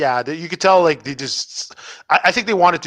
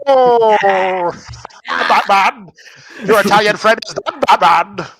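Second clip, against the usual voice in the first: higher, louder gloating villain; villain taunt; 5 semitones higher, 5 dB louder, slower than usual. Acted. Taunting Batman as a villain, higher and louder. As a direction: crow over Batman like a supervillain.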